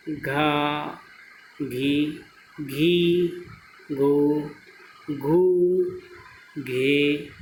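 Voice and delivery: slow syllable-by-syllable recitation of the ख, ग and घ rows; reciting syllables. Shown as a demonstration. Teacher reciting the barahkhadi to students, slow and clear. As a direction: say each syllable clearly with a pause after it. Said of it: "देखिए आगे".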